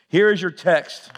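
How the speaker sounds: ordinary talk, nothing marked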